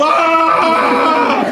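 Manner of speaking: high, loud ape roar; mock gorilla roar. Gorilla imitation for the audience, loud roar. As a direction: roar like the gorilla stopping mid-court.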